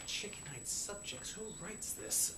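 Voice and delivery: slow, incredulous whisper; hushed grumble at the script; whispered aside. Real, not acted. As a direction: mutter it under your breath in disbelief. Muttered aside about the script.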